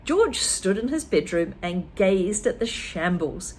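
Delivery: plain reading voice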